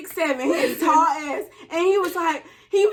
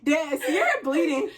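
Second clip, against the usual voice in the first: retold plea; 3 semitones higher, slower than usual. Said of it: "I was like".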